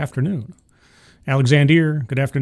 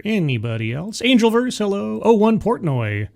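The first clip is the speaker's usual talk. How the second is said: ordinary talk, nothing marked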